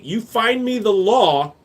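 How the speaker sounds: ordinary talk, nothing marked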